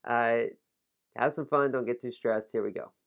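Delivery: ordinary talk, nothing marked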